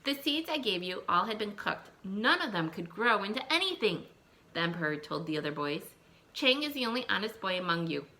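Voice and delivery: put-on lively read-aloud for young children; storybook reading. Teacher reading a folk tale to her class, lively storytelling.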